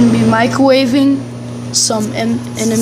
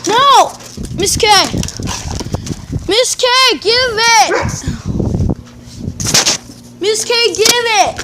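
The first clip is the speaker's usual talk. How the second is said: yelling at dogs; 9 semitones higher than usual. Real, not acted. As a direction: snap at a naughty dog. Dog grabbing the food.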